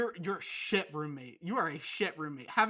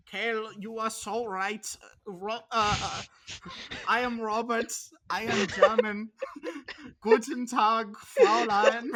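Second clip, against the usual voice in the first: mock German accent; 3 semitones higher, 8 dB louder than usual. Roommate imitating German friend Robert in mock German accent. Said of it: "it's like he's here with us".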